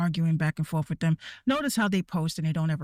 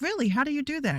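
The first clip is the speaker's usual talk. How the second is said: mock surprise; 8 semitones higher, faster than usual. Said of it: "you'll be in the comments like".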